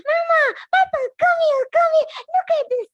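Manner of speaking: very high, loud excited cub; Baby Bear calls Mama and Papa, high and excited. Acted. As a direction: call the parents over in excitement. Baby Bear finds Goldilocks.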